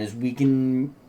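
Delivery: ordinary delivery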